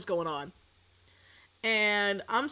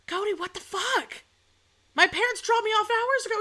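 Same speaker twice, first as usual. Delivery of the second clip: pitched way up, mock outrage; high outraged squeak; 12 semitones higher, 3 dB louder than usual. Girlfriend squealing at her boyfriend over his lie, high mock outrage. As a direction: squeal at him in disbelief.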